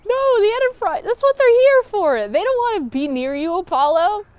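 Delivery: exasperated protest. Acted. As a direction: jump in to defend the Enterprise. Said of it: "And I'm like".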